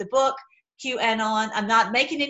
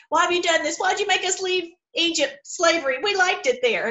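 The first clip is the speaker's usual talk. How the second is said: higher panicky mock complaint; whining crowd pitched up; freaking-out people; 6 semitones higher than usual. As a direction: mimic the panicked grumblers. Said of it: "they're all freaking out".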